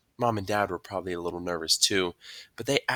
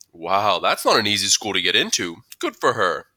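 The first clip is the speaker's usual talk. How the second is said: impressed school principal; 8 dB louder than usual. A narrator's reading. Mister Tushman voiced, impressed.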